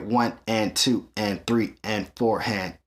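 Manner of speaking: "one and two and three and four" counted evenly and slowly, each "and" the same length; steady straight eighths count. Shown as a demonstration. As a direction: count it dead even, no swing. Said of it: "This is straight".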